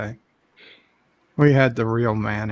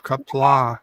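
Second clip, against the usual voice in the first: ordinary delivery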